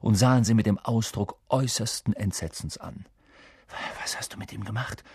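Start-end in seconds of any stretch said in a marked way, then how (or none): 4.0-5.0 hushed, quick question; softly whispered, urgent pace. A narrator's reading. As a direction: murmur it softly and fast. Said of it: "flüsterte Harry".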